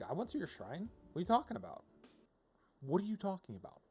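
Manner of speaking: ordinary talk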